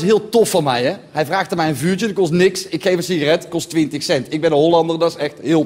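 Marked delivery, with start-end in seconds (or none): none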